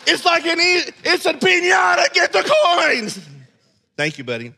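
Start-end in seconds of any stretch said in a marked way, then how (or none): 0.1-3.2 higher, louder gleeful yell; excited shout. Retold or imitated. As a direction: cheer as if a piñata burst. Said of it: "we're like".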